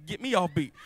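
ordinary talk

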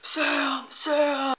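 high, mock-urgent cries; repeated high-pitched calls, half-screamed; retold panicked scream. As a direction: imitate someone screaming a name, not at full volume. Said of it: "with Alex screaming over my face".

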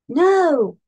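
high, loud storybook plea; begging. A narrator's reading. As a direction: cry out in alarm, pleading. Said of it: "begged Snail".

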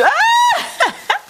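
squealing laugh of joy, real; delighted laughing shout. Real, not acted. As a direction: burst out in a high, shouted laugh. Overjoyed at a gift.

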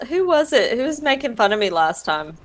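normal talking voice

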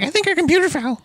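high, airheaded mock friend voice; mock clueless friend. Mocking the horror movie's oblivious friends: high, airheaded voice. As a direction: play the oblivious friend, a touch silly. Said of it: "stupid friends ... were like".

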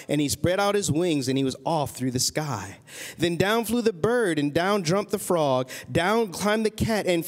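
lilting rhyming read-aloud; story narration. A narrator's reading. Parent reading a witch story to a child, storybook narration. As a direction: read it to a child in steady verse. Reads a picture book aloud.

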